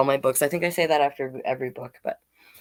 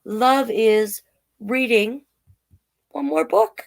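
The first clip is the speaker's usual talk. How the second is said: slow, sing-song picture-book read; reading to children; 3 semitones higher, 3 dB louder, slower than usual. Acted. Drag queen reading a picture book to kids, slow and sing-song. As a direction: read each page slowly to the little ones.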